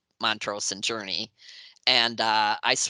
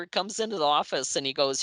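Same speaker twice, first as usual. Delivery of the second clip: usual speaking voice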